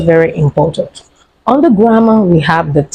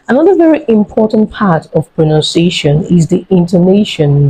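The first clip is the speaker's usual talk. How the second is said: ordinary talk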